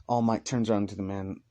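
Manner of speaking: usual speaking voice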